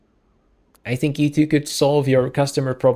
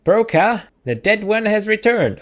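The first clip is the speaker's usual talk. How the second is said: put-on high-pitched old-lady call; 7 semitones higher than usual. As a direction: call your husband in a high old-woman voice. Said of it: "I was doing the old lady voice".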